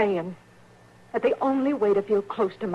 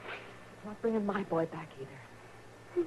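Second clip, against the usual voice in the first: quiet grief; 11 dB quieter than usual. Acted. Grieving mother answers softly.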